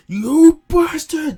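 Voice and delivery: slow, very loud, high enraged scream; furious scream. Retold or imitated. Future Trunks attacking Vegeta, stretched out furious scream. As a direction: scream it as you lunge at him. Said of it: "Vegeta is like".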